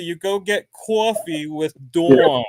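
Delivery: proud New Jersey pronunciation; New Jersey accent. Shown as a demonstration. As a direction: lean into New Jersey vowels. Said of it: "So in New Jersey".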